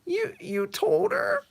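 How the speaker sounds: very high, slow, stammering; high disbelief, drawn out; shocked Jimmy. Acted. Jimmy asks Bibbo if he told her, high shocked stammer.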